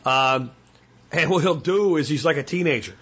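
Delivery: ordinary talk, nothing marked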